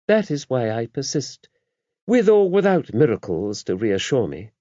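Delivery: mother superior confiding in a priest; the abbess. A narrator's reading. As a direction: voice the abbess kindly and knowingly. Abbess voiced in the story.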